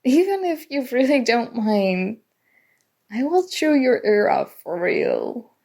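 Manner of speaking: usual speaking voice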